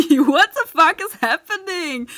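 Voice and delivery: loud, high, slow shout breaking into laughter; laughing shout. Real, not acted. Streamer overwhelmed in a firefight, laughing shout. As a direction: yell in panicked laughter.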